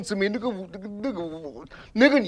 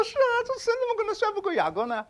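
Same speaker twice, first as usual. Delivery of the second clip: much higher, a touch softer, wondering sister; mock sister voice; 14 semitones higher, 5 dB quieter than usual. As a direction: lightly play the puzzled sister asking back. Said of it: "姊妹说".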